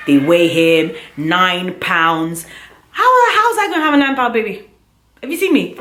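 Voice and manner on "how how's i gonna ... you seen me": high, fast, stunned disbelief. Real, not acted. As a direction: shriek it out in disbelief. Shock at nine pounds.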